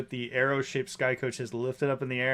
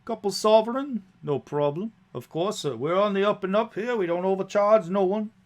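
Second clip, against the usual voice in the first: higher-pitched, louder folksy driver; chatty coach driver; 7 semitones higher, 5 dB louder than usual. Acted. Driver assures passengers of an honest fare, chatty and high. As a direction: quote the fare with easy assurance.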